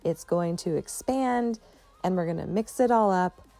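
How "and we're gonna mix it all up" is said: normal talking voice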